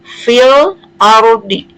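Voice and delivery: very slow "Fil ardi", the l of Fil clearly sounded; clearly read lam. Shown as a demonstration. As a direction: recite "Fil ardi" in separated, drawn-out syllables. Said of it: "jadi dibacanya jelas".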